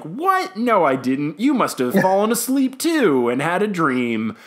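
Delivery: high-pitched mock astonishment; flustered denial. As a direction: deny it high, imitating an indignant teacher. Retelling Trelawney's flustered denial.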